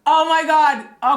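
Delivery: a high, loud gasp of Oh my God; surprised exclamation. Real, not acted. Host hears sudden news off camera, loud surprised oh my God. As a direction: caught off guard by sudden good news.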